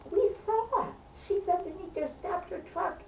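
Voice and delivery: very high, soft mock shout; high squeal of insistence, kept small; urgent retold yelling. Neighbours yelling at the driver, retold.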